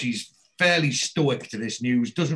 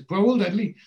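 pitched up high, scoffing disbelief; incredulous echo; 8 semitones higher, 5 dB louder, faster than usual. Retold or imitated. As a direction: throw the words back, amazed. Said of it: "Dennis says".

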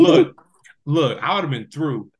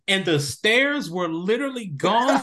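ordinary talk

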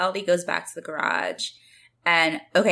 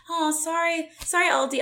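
high-pitched sympathetic cooing; sweet sympathy; 12 semitones higher than usual. Retold or imitated. Classmates consoling L D about her dad, sweetly. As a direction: offer condolences in a high, sweet voice. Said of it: "the kids are so sweet".

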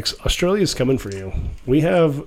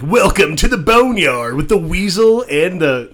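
slow, higher, louder DJ announcer voice; radio DJ voice; 6 semitones higher, 5 dB louder, slower than usual. Pretend. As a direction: boom it out like a rock radio host. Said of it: "that sounds like a radio D J kind of thing".

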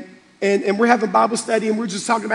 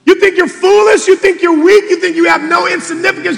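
loud, high, driving sermon voice; full-throated pastor's build; preaching at full force; 8 semitones higher, 6 dB louder than usual. Acted. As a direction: preach it out to the back row.

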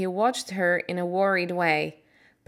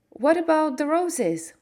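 put-on higher, worried girl's question; anxious for the roses, higher; 8 semitones higher, 4 dB louder than usual. Mary asking Dickon about the roses, worried and higher. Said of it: "asked Mary worriedly".

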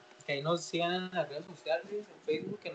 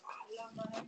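whispered gasp; 13 dB quieter, slower than usual. Retold or imitated. Shocked moms murmuring.

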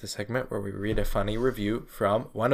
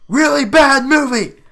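high, loud, drawn-out yell; slow full-volume holler; all-caps yell; 14 semitones higher, 11 dB louder than usual. Shown as a demonstration. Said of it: "yell when we do it".

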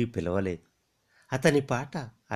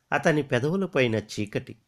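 ordinary delivery

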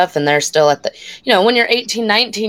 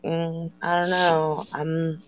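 softer, slow, unconvinced reply; doubtful mumble; 8 dB quieter, slower than usual. Retold or imitated. Student doubting the classmate's claim about smart kids, softly. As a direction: let it trail off doubtfully. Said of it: "And I'm like".

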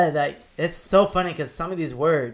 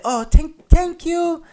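high, louder imitation of a girl's thanks; flattered girl; 13 semitones higher, 5 dB louder, slower than usual. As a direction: squeal the thanks like a delighted girl. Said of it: "they say".

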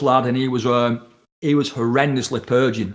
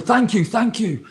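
grateful thanks; 6 semitones higher, slower than usual. Retold or imitated. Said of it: "He's going".

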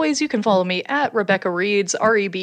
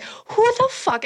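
high, slow startled cry; a much higher panicked question; 14 semitones higher, slower than usual. Retold or imitated. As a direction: alone in the apartment, panicking at the jiggled door handle. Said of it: "I'm like".

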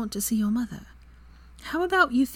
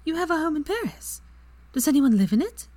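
much higher incredulous question; eyes wide in disbelief; astonished; 11 semitones higher, 3 dB louder than usual. A narrator's reading. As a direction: Hermione stunned by Draco's Paris home, astonished. Hermione astonished.

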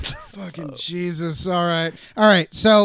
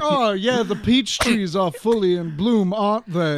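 imitating Georgian aristocrat; 2 semitones higher than usual. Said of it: "Georgian aristocracy".

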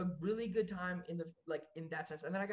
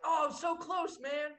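higher, louder mock cheer from the crowd; 9 semitones higher, 5 dB louder, slower than usual. Imitating people at the floor cheering him on excitedly. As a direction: cheer them on like a fan. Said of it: "people were like".

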